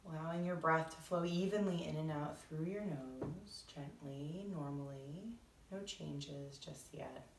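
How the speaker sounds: soft, measured meditation voice; guided meditation. Acted. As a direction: ease listeners gently into breathing, unhurried.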